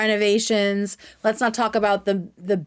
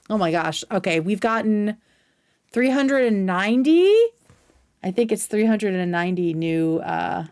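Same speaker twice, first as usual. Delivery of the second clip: ordinary talk, nothing marked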